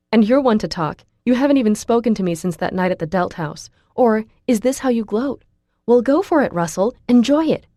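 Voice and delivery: put-on girl shouting at her old friend; angry shouting. A narrator's reading. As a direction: hurl the taunts at him. Heated hallway quarrel, she shouts.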